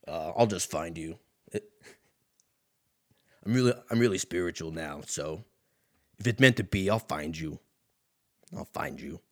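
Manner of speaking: low-pitched suitor playing it cool; hammed-up low voice turning down a number; smooth-guy character. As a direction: drop low and murmur the mock-spiritual line. [name] answers the falsetto woman.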